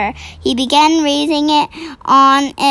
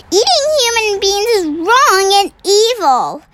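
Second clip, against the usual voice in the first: higher, earnest objection; earnest giant; 7 semitones higher than usual. Acted. The BFG scolding the giant, higher earnest reply. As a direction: insist it is wrong.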